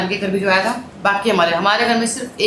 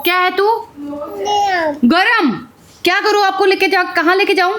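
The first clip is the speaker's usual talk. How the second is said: high playful mom voice; 8 semitones higher than usual. Real, not acted. Mother talking to small daughter. (छोटी बच्ची से ऊँची, लाड़ भरी आवाज़ में बात)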